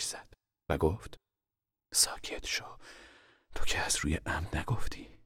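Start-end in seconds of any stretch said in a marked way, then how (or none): 1.9-5.2 soft, whispered comfort; soothing mother. Acted. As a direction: hush a sobbing child gently. Mother soothes crying girl, stroking her.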